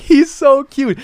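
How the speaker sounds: high gushing coo. Retold or imitated. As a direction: melt over the puppy. Cooing over puppy.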